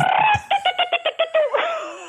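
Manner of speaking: long high howling Sasquatch call; bigfoot cry. Shown as a demonstration. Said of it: "Encore juste un petit cri de".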